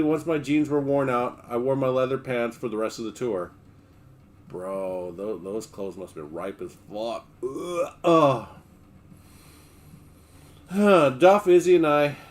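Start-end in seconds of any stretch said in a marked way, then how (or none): 4.5-7.3 low quiet disgust; grossed out aside. Real, not acted. 7.4-8.5 grossed-out ugh; disgusted groan. Real, not acted. Disgust noise.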